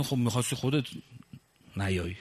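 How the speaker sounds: fast, low, dismissive mock voice; mocking the officials. Speaker mimics officials brushing off protesters, low and dismissive. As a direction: toss off their excuse with a sneer.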